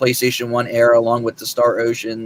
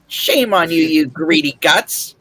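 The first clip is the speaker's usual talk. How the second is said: higher sly trickster taunt; Patches; 5 semitones higher, 3 dB louder than usual. Pretend. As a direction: gloat like a sneaky betrayer. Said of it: "try to do that with Drake or Frederick".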